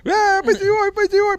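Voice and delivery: high, excited call of a nickname across the crowd. Retold or imitated. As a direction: hail him like an old friend on arrival. Mimics greeting at the hotel. (llamado alegre y muy agudo)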